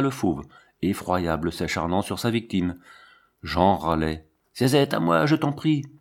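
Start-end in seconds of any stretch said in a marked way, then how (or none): 4.5-5.9 higher-pitched cry for rescue; pleading for help. A narrator's reading. Dying Jean begs Zézette to save him, higher plea. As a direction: beg for help with the pitch raised.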